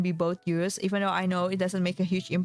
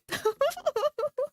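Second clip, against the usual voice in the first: a short high-pitched giggle; light amused giggle. Real, not acted. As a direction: giggle at your own complaint. Laughs at own paper straw gripe.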